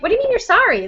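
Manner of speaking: high-pitched retold challenge; exasperated mom, lightly acted; incredulous parent. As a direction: question it in mild disbelief. Parent challenging kid's reflex apology, retold.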